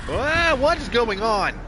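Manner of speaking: high, loud, drawn-out startled cry; surprised. Real, not acted. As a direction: yelp it out slowly, caught off guard. Startled by sudden game event.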